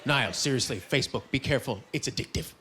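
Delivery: low, mock-earnest caution; grave warning. Friend cautioning him about Facebook addiction, mock grave.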